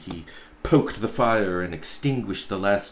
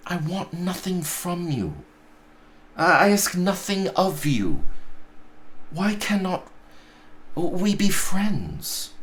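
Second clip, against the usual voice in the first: put-on higher, earnest young nephew; nephew pleading; 9 semitones higher than usual. Nephew begs his uncle to explain, higher and earnest. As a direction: plead gently.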